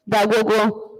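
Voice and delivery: ordinary delivery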